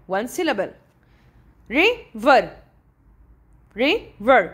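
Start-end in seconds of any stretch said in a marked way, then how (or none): 1.7-4.5 River in two beats, ri, ver; split into syllables. Shown as a demonstration. Teacher asking for the count, River split in two. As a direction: pause inside River after the first syllable. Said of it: "Two syllable".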